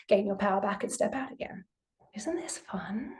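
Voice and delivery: hushed sarcastic aside to the group; ironic whisper. Real, not acted.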